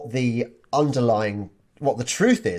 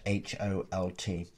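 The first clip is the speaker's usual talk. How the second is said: ordinary delivery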